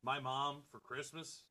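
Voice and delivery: distant, muffled mic; far from mic. Real, not acted. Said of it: "Your sound is whacked".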